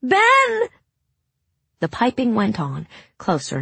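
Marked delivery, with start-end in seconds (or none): 0.0-0.7 very high, loud cracking yell; panicked cracking shout. A narrator's reading. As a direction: scream for help, voice breaking. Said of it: "her voice cracking with panic".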